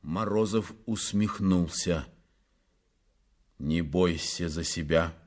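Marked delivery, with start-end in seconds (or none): 3.6-5.1 low, cool, restrained menace; icy low composure; cold. A narrator's reading. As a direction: say it coldly, pitched low. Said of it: "сказал он холодно".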